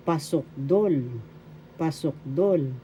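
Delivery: "Pasokdol" said pasokDOL, last syllable drawn out; stress on third syllable. Shown as a demonstration. Teacher modelling the superlative term, "Pasokdol" stressed on "dol". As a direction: put the accent on the final "dol" of "Pasokdol". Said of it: "The accent is in the third syllable".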